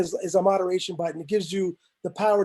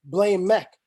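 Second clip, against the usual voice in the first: normal talking voice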